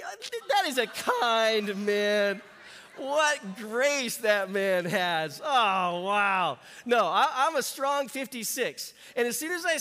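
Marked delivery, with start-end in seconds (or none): 0.0-5.4 mock-grateful. Real, not acted. Joking gratitude for younger guess. 5.4-6.6 mock surprise. Real, not acted. Reacting to age guess.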